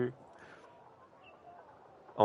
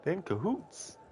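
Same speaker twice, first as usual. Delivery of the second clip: lighter, quicker imitation of Alex; Alex's line again; 9 semitones higher, 7 dB quieter than usual. Streamer repeating the game character's line, lightly imitated. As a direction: toss off Alex's line once more. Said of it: "I love the way Alex says that".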